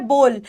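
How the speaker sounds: बोल with a rounded long "oh"; correct pronunciation. Shown as a demonstration. Teacher repeating the correct form, long "oh" in बोल. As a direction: hold one "oh" vowel in बोल. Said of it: "correct pronunciation होती है".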